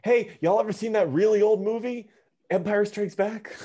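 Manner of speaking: put-on high, eager teen voice; young kid voice. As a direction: play a wide-eyed young kid. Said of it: "Jesus, [name], how old is this kid?".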